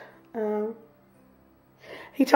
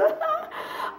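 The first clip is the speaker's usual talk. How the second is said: high giggle; 8 dB quieter than usual. Real, not acted. Laughs at herself.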